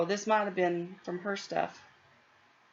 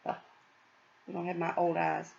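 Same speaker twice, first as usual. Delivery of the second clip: normal talking voice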